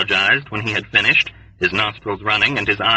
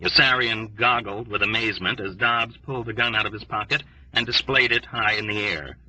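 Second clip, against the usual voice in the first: usual speaking voice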